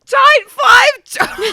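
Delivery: loud, high, drawn-out shriek; shouted, cracking into laughter; incredulous outburst. Real, not acted. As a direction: shriek the title in disbelief. Cracks up repeating the title.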